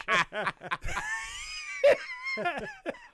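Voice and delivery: long, high-pitched burst of laughter; loud high laugh. Real, not acted. Co-host cracking up after teasing, loud laughter.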